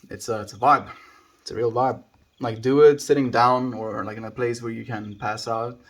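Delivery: usual speaking voice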